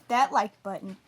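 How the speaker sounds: ordinary delivery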